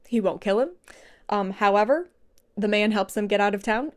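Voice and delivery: ordinary talk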